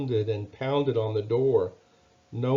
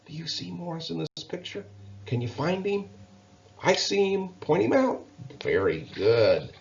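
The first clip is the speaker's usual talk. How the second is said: bright, coaxing read-aloud questions; reading to children; 6 semitones higher than usual. Acted. Storyteller coaxing children to spot the mouse. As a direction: invite the little ones to find him. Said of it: "reading to children, asking listeners".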